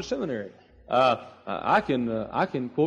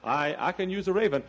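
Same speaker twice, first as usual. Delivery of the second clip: full-force preaching; 9 semitones higher than usual. Acted. Preacher voicing God to Elijah, high impassioned delivery.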